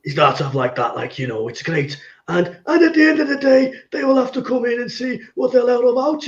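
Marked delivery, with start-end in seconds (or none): 1.5-6.2 high, sing-song manager voice; Cook impression. Co-host mimics the manager's press conference, high up-and-down impression. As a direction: send the voice right up, imitating a football manager. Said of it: "we got multi-tone Cook back now".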